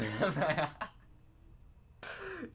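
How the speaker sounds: a helpless laugh that spills over; burst of laughter. Real, not acted. To her viewers, cracking up at the memory, helpless laughter.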